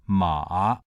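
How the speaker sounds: "ma" low and dipping, then rising; Mandarin third tone. Shown as a demonstration. Lecturer demonstrating Mandarin tone number three, dipping "ma". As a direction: dip "ma" down and bring it up. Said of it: "Mandarin tone number three".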